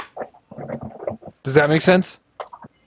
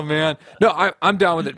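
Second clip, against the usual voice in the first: ordinary talk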